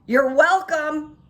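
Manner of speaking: "You're welcome" loud, high and slow, dripping sarcasm; sarcastic you're welcome. Retold or imitated. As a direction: call it out pointedly after her. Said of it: "this is what came out of my mouth".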